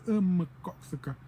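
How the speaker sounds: slow drawn-out "Um, mgoxka"; slow, careful dialect demo; slow dialect form. Shown as a demonstration. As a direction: say "mgoxka" slowly, as the elders do. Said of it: "they would say".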